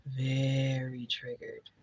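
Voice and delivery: very triggered, stretched slow and mocking; drawn-out echo. Shown as a demonstration. Repeating the commenters' word back, slow and mocking. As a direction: savor each word, teasing the commenters. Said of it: "a lot of people in the comments that are very triggered".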